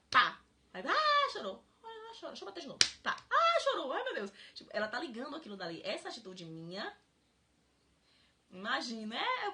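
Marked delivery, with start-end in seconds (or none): 0.1-4.3 loud, high burst of delight at the crying; excited child. Pretend. Child hitting a friend and delighting when she cries, loud and high. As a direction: play the child thrilled by the reaction.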